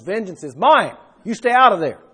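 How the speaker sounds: commanding. Acted. Preacher voicing God warning people off vengeance, commanding.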